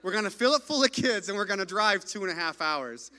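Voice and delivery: mildly hyped, loud and cheery; upbeat. Retold or imitated. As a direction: pitch it like a fun surprise plan. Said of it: "he was like".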